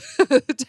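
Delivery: burst of laughter. Real, not acted. Laughs at husband's mix-up.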